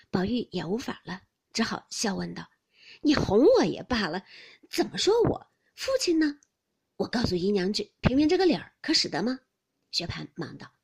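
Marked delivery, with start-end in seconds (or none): 3.0-9.4 loud, half-serious reproach; raised-voice remonstrance; indignant protest. A narrator's reading. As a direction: take him to task over the prank. Baoyu protests the prank to Xue Pan.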